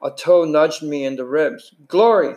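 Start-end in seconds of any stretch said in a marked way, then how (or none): 1.9-2.3 rough voice. A narrator's reading. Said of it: "said a rough but familiar voice".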